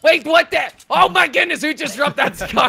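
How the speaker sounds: loud high astonished shout; surprised shout. Real, not acted. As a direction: yell in disbelief. Sudden loot drop.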